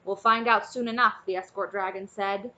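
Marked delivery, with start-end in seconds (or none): 0.0-1.2 raised voice. Acted. Escort over crowd.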